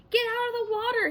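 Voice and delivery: high, loud shout; panicked scream of warning. A narrator's reading. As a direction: yell it to kids in the water. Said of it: "he screamed".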